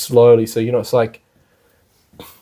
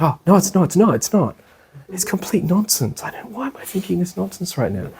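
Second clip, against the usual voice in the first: higher, fading to a softer mutter; exasperated self-talk; 8 semitones higher, 4 dB quieter than usual. Pretend. Meditator scolding his own wandering thought, higher, then trailing off softer. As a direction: brush off your own silly thought. Mind arguing with itself.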